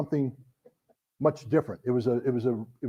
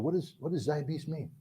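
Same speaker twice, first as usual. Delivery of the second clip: quiet question; 12 dB quieter than usual. Retold or imitated. Asking quietly about the word.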